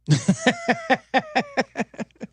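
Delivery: a sudden high-pitched burst of laughter. Real, not acted. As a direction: laugh high and hard at your own losing bet. Laughing at his own bad luck.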